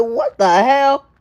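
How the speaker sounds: shocked. Retold or imitated. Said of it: "I'm shocked".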